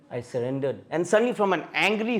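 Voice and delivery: ordinary talk, nothing marked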